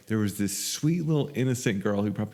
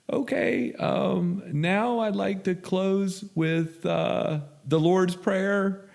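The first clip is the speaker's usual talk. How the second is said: put-on high, slow, timid girl voice; a nervous newcomer squeaking out the closing; a shy young woman, high and slow, running her first meeting; timid little girl; 10 semitones higher, much slower than usual. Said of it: "she's just shaking and innocent".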